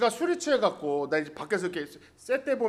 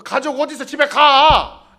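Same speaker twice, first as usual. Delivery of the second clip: bystander scolding; 2 semitones higher, 8 dB louder than usual. Pretend. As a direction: tell him off for lying there. Imagined bystander scolding Jacob.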